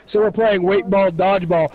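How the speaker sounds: normal talking voice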